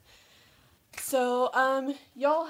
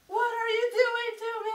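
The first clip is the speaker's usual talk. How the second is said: mock alarmed high; 9 semitones higher than usual. Mock outrage at niece.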